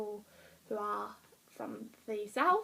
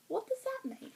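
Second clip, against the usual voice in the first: high-pitched, softer puzzled question; puzzled reader; 13 semitones higher, 6 dB quieter than usual. Acted. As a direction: play a baffled reader, voice jumping high.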